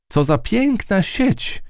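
high, slow admiring mouse voice; high slow admiration. A narrator's reading. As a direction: marvel at the web. Matylda admires the web.

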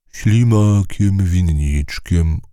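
very slow, unhurried creature voice; a gentle snail taking its time; slow drawn-out snail reply. A narrator's reading. Snail names itself.

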